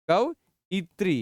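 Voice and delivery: "कवयित्री" drawn out, every syllable clear; slow careful pronunciation. Shown as a demonstration. Teacher repeating the answer "कवयित्री" slowly. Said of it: "कवयित्री है".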